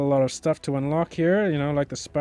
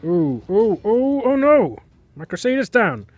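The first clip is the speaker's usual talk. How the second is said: alarmed cries; 6 semitones higher, 8 dB louder than usual. Real, not acted. His unit dies in battle.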